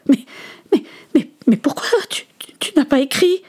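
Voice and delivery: high, stammering disbelief; bewildered son. Acted. Son asks his absent father about writing, bewildered. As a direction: stumble into the question, pitched high.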